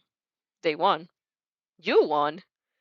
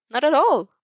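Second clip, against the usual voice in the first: sly and cheeky; 7 semitones higher, 7 dB louder than usual. Acted. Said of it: "a sly, cheeky smile".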